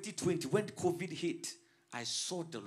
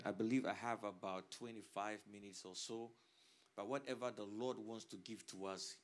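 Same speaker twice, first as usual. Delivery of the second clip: ordinary talk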